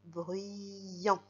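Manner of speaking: "Bruyant" drawn out through the y glide; stretched glide. Shown as a demonstration. Teacher showing how Y makes the i sound, "Bruyant" stretched. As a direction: hold the i sound in the middle of "Bruyant". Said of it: "il tend son bras pour faire le son I".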